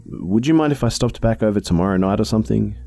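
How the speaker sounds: put-on polite young woman. Narrator voices herself.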